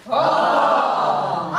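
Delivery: breathy oh. Shown as a demonstration. Teacher leading the choir in a breathy oh. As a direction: sigh it out on pure air. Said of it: "with a lot of breath".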